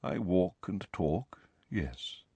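slow, low, fading to a near whisper; subdued, measured admission; quiet. A narrator's reading. Ghost concedes to Harry that he walks, quietly. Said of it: "said nearly headless Nick quietly".

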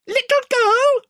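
tiny, very high indignant squeak; Reepicheep. A narrator's reading. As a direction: repeat it loudly in a piping voice. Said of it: "said Reepicheep".

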